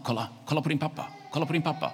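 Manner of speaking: high, quick eager call; excited urging. Acted. Imaginary friend at window urges Anton to look, excitedly. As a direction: urge him to look, high and hurried.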